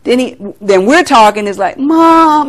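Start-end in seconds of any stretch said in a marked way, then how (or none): 1.8-2.5 higher, drawn-out "Mom" imitating a son; lightly voiced whiny protest to mother; son's exasperated protest. Voicing her son's exasperated reply.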